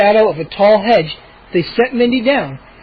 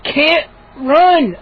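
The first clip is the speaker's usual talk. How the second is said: choked out; 8 semitones higher, 3 dB louder than usual. Acted. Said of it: "I choked out".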